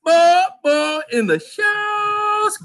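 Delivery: hammed-up pleading cry; mock cry. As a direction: wail it out like a begging fan. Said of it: "he cried".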